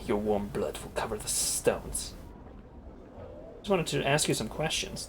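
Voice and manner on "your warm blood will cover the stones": low, slow creature menace; lower, slow fiend voice promising violence; rasping fiend threat. Acted. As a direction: threaten slowly from deep in the throat. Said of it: "The creature speaks in a rasping hiss".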